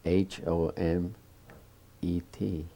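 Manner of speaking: slow, letter by letter; spelling it out. Shown as a demonstration. Spelling his hometown's name for the interviewer, slowly letter by letter. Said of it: "Would you spell that".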